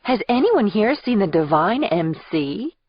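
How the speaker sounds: high, singsong motherly call; sweet maternal voice. Acted. Aunt pretending to look for her hiding niece, sweet maternal voice. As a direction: call out sweetly, playing at a search. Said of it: "in my sweetest maternal-sounding voice".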